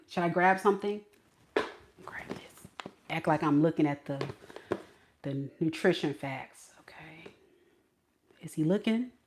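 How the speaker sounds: very soft, low, half-whispered; furtive muttering. Acted. Woman pretending to read labels near her crush, furtive whispering. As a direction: mutter secretly so nobody overhears. Pretending to shop, secretly watching.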